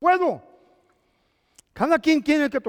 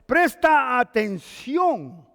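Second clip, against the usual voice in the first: "Presta atención" stretched very slowly, word by word; drawn-out emphasis; much slower than usual. Real, not acted. Preacher repeats the verse's command to the congregation, "Presta atención" slowly drawn out.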